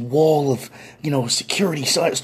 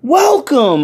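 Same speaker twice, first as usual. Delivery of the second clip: high, exclaimed greeting; crowd's hearty welcome; 10 semitones higher, 4 dB louder than usual. Retold or imitated. Cookout crowd welcoming the newcomer, hearty shout. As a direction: call it out like a whole crowd.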